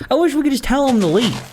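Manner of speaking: ordinary talk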